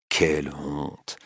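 «Quelle honte! slow, low and soft; muttered shame. A narrator's reading. Duroy alone, muttering «Quelle honte! to himself, softly.

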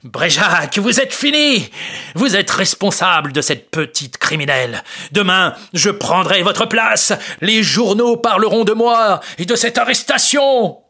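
triumphant gloating. Acted. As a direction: crow over the beaten rival. Marescal triumphs, accuses Bréjac.

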